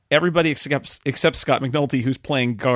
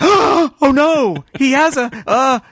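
loud, high mock horror; acted dismay; 13 semitones higher, 8 dB louder, much slower than usual. Host reliving his discovery of the flaw, mock-horrified. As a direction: gasp it out in exaggerated alarm. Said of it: "I went".

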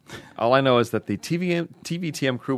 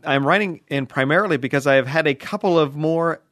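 email reading; 6 semitones higher, 4 dB louder than usual. Acted.

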